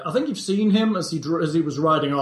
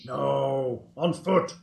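lightly voiced goblin, broken speech; a hint of a simple goblin. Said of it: "That should make easier goblin voices".

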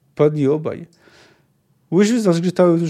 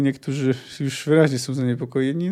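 ordinary delivery

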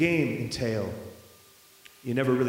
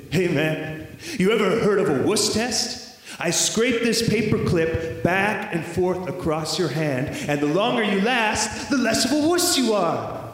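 schoolboy bully's challenge, rising and louder; taunting dare; 4 semitones higher, 4 dB louder than usual. Acted. Classmate dares boy to a wuss test, taunting. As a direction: goad him, building to the last words.

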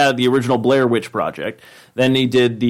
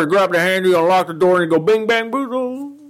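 high, fast excited commentator; John Madden impression; 8 semitones higher than usual. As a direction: explain it like a hyped announcer. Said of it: "show him how to open the door".

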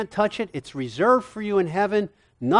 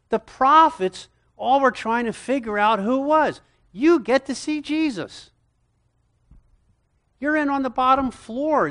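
high, loud, marvelling build-up; rising excitement; 8 semitones higher, 4 dB louder than usual. Real, not acted. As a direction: preach it like it's too good to believe. Preacher building excitement about salvation.

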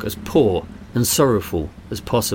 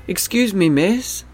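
put-on high, small voice of a begging boy; weak child voice; 10 semitones higher than usual. A narrator's reading. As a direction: plead up high like a little street kid. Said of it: "came a weak voice".

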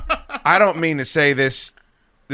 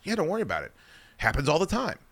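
softer, lightly voiced counter girl; pizza girl's reassurance; 4 semitones higher, 6 dB quieter, faster than usual. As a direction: brush it off softly, no big deal. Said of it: "She's like".

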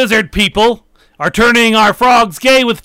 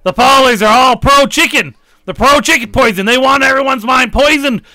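conspiracy rant rising to a yell; building rant; 3 semitones higher than usual. Acted. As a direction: work yourself into a frenzy about the Paulers. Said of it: "You need to calm it down".

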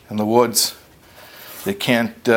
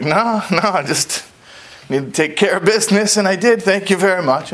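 breezy bear; 8 semitones higher than usual. Acted. Bear brushes off the tree.

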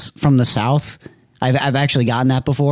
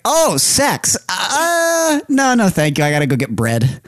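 high-pitched, awkward polite refusal; flustered retold realisation; 7 semitones higher, slower than usual. Man declining a prostitute's offer, flustered. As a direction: act out sudden understanding, pitch jumping up.

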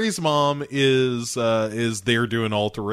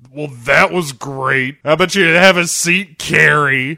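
loud, raised mock-teacher voice; put-on condescending teacher, getting louder; sarcastic teacher; 4 semitones higher, 6 dB louder than usual. Teacher dismissing Carrie after her poem, mock teacher voice. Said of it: "the teacher is like".

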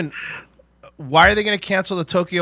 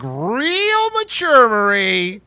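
much higher, slow, sing-song "Real mature, Marie"; Chandler sarcasm; 5 semitones higher, 5 dB louder, much slower than usual. Retold or imitated. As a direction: stretch it out high like Chandler mocking a friend. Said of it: "sounds like Chandler".

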